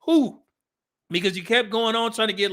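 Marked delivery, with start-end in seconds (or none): 0.1-0.4 rising owl-like hoot, higher. Retold or imitated. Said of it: "Sounded like an owl".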